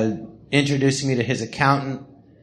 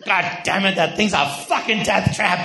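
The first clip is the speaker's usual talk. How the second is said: a light take on a gruff, stern father; stern dad; 4 semitones higher than usual. Retold or imitated. Imitating his stern dad about the car, a mild mock grumble. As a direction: bark it lightly like an annoyed father. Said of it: "who's stern like that photo".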